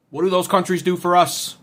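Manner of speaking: ordinary delivery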